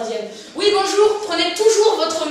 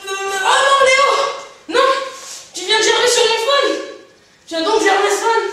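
higher, louder dismayed yell at Léo; upset shout; 7 semitones higher, 7 dB louder than usual. Acted. Doctor to sick Léo, louder horrified yell. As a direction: cry out as if your phone got ruined.

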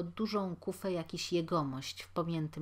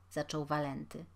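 ordinary delivery

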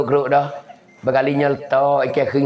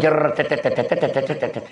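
rolled burst into rapid "tet-tet" stutter; rapid-fire staccato noise; imitated sputtering; 3 dB quieter than usual.

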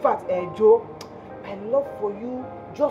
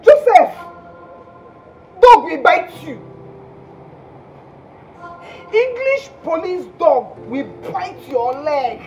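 venomous cursing; 3 dB louder than usual. Acted. Jilted caller cursing Joseph abroad, loud venomous outbursts. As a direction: hurl each curse separately, with pauses between. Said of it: "why are you spitting so much venom".

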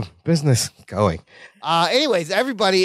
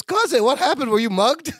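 worried cousin imitation, pitched much higher; mock alarm; 8 semitones higher than usual. Cousin greeting his disheveled relative, mock-alarmed. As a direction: ask in hammed-up concern, voice lifted.

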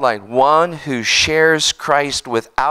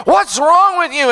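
high, loud mock exasperation; imitating his own frustration; exasperated; 11 semitones higher, 4 dB louder than usual. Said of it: "I would think".

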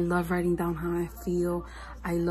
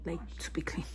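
whispered, much softer; whispered repeat; 8 dB quieter, faster than usual. Real, not acted. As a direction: drop it to a whisper. Repeats phrase, second time whispered.

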